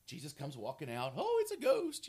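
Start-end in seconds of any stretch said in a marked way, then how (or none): 1.2-2.1 high mock-scared cry; hammed-up terrified disciple. Preacher mimicking bad movie actor's ghost scare. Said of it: "terrible acting".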